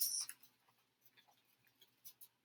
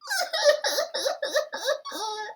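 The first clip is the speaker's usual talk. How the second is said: put-on high baby sob. Baby rhino's cry.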